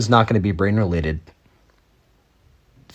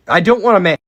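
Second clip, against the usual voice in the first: exasperated protest; 8 semitones higher, 4 dB louder than usual. Real, not acted. Repeating protest.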